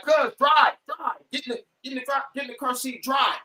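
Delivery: high, fast, frantic orders to drive; urgent cousin yelling. Retold or imitated. Cousin jumping in the back seat, frantic high orders to drive. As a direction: hurry him off in a panic, pitched high. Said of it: "He's like".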